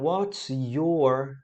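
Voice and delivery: slow "your" with all three sounds stretched; teacher stretching "your" to show I, O and R; drawn out. Shown as a demonstration. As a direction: pull "your" out long and deliberate. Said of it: "eu gosto de puxar assim".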